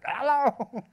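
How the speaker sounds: playful high-pitched holler; big comic shout breaking into laughter; screaming down a closet. Pretend. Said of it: "scream Dharma down the, down the closet".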